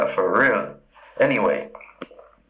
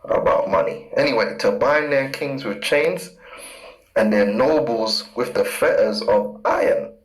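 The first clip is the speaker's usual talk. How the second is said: ordinary talk, nothing marked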